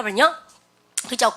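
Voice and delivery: normal talking voice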